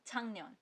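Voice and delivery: झंगन्योन with ng before nyon, no k stop; correct assimilation. Shown as a demonstration. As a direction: say झंगन्योन smoothly, k turns to ng. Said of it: "हम लोग इसे पढ़ेंगे".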